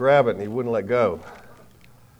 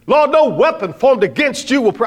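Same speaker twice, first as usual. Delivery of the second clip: much higher and louder, fiery praying voice; fired-up Pentecostal prayer; 11 semitones higher, 9 dB louder than usual. Retold or imitated. Preacher mimics a locker-room prayer, fired up and loud. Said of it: "I like being fired up".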